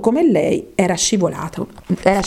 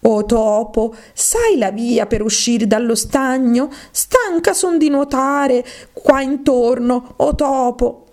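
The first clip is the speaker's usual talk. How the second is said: slow, higher-pitched plea to the mouse; drawn-out, weary appeal; Alice, formally addressing; 6 semitones higher, slower than usual. Acted. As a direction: address the mouse as in a grammar book.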